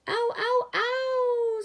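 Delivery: very high, loud pained scream; wolf lands in boiling water, screaming. A narrator's reading. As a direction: scream as if scalded. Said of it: "screamed the wolf".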